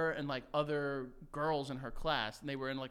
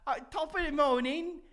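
a hammed-up, higher stab at an Irish accent; bad Irish accent; 10 semitones higher, 5 dB louder than usual. Quoting his Irish pen-pal girlfriend in a bad Irish accent. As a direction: fumble a mock Irish accent. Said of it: "I can't do an Irish accent right now".

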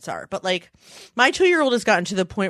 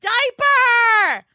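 put-on high shouted toddler demand; 4 dB louder, slower than usual. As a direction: bark it like a bossy two-year-old. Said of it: "toddler demands".

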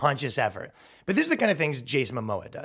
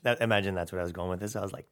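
ordinary talk, nothing marked

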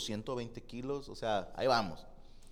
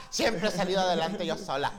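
louder, laughing put-on voice; mock woman; 5 semitones higher, 6 dB louder than usual. Mocking the single mom, louder, laughing. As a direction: play the struggling single mom.

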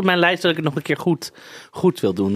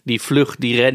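ordinary delivery

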